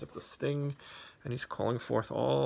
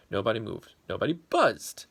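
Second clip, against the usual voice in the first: louder, animated storybook telling; lively story narration; 7 dB louder than usual. A narrator's reading. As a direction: tell it big for the kids. Reads story to children.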